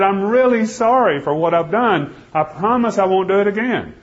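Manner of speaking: pleading apology. Retold or imitated. A condemned man begging the judge, pleading high and earnest. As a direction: beg for mercy, promising to change. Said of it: "I've begged, and I've cried, and I pleaded".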